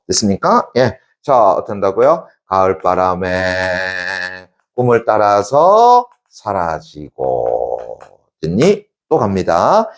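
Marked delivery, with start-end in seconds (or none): 2.5-8.0 very slow, held phrase endings; drawn-out reading. Shown as a demonstration. Teacher repeats the poem line for class, drawn out. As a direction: stretch each phrase, easing down at the close.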